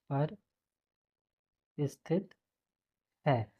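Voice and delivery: soft, word by word dictation; quiet slow dictation. Shown as a demonstration. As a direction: murmur each word as you write it. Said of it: "dictating heading while writing".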